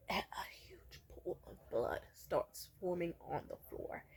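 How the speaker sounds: slow, low whisper; hushed reveal. Real, not acted. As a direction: whisper it slowly for suspense.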